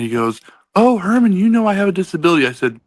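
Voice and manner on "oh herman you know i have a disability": high, loud mock pleading; mimicked Kyle. Friend making excuses when confronted, mimicked high and loud.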